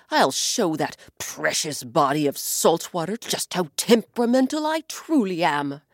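a lightly voiced vengeful sky; a hint of a wounded threat; angry sky. Sky threatening to show the ocean his temper.

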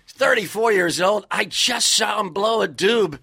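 loud, high excited sportscaster call. Acted. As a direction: announce it like a pumped-up TV sportscaster. Sportscaster character voice.

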